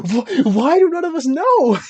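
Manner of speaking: high, loud incredulous outburst; laughing exasperation. Real, not acted. Podcaster baffled that nobody knows the singer, high exasperated cry. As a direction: cry out in amused disbelief.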